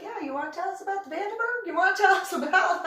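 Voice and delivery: high, loud mock interrogation; insistent prodding. Group grilling a diver about the Vandenberg, insistent prodding. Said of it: "they're like".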